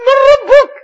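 loud, high stern question; interrogating angel. Retold or imitated. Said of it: "they will ask us".

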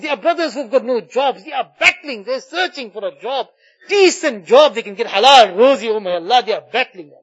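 ordinary talk, nothing marked

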